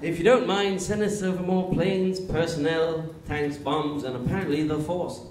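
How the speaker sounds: British statesman on a phone call; Winston Churchill. Acted. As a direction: put on a pompous British accent. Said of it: "Winston calls up Roosevelt".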